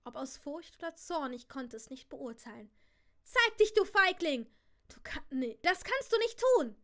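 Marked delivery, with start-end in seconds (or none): 3.2-6.8 high, loud raging shout; furious shout. A narrator's reading. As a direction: rage at an unseen enemy. Aunt shouts at reaper.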